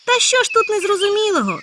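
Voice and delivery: high loud exasperated exclamation; impatient and irritated. Acted. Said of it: "з нетерпінням та з роздратуванням вигукнув". (з нетерпінням і роздратуванням вигукнув)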